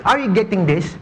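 normal talking voice

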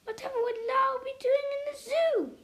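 upset owner protesting the zoo order; miserable protest. A narrator's reading. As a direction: wail the question in dismay. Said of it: "exclaimed miserably".

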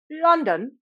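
badly bluffed British accent; fake British accent. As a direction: pretend to be British. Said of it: "pretending to be British".

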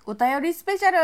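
loud title call of お便りスペシャル; shouting out お便りスペシャル like a title; loud announcement. Acted. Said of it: "announcing the show segment".

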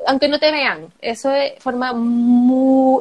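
normal talking voice

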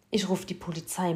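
plain reading voice

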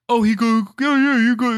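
imitating the dad. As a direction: ham up the sister's impression of the dad. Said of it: "This is how she imitates Almond".

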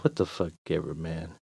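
low, soft dismissive grumble; muttered. Acted. Walker to himself as he leaves, muttering. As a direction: mutter it under your breath. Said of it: "I muttered to myself".

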